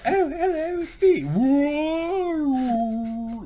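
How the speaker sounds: squeaky high whale voice calling out. Pretend. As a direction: play a goofy high-pitched whale saying hello. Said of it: "That's my whale impression".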